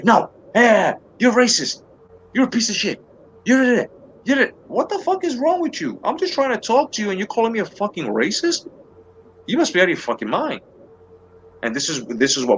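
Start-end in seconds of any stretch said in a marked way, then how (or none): 0.0-4.5 high-pitched, sputtering name-calling; mock angry accuser. Opponent on the left shouts down the speaker, mock outrage. Retelling how the left argues back. 4.7-10.6 high, incredulous protest, easing down by the end; indignant comeback. Acted. Acting out his indignant reply.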